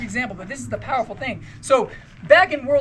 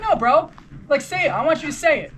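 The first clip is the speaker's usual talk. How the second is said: higher-pitched imitation of a pushy pacer; insistent urging; 6 semitones higher, 4 dB louder than usual. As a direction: press a reluctant buddy to say it. Pacer urging reluctant runner.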